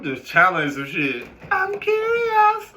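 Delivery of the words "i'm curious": squeaky high-pitched slow "I'm curious"; drawn-out falsetto mockery of nosy people. Mocking nosy people in a high squeaky voice. Repeated mock voice.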